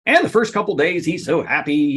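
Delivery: usual speaking voice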